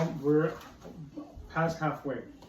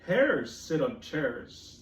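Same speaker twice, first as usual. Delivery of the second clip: very slow, drawn out; slow cat line; much slower than usual. Acted. Teacher reading cat's rhyme very slowly. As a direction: stretch every word. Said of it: "said the cat".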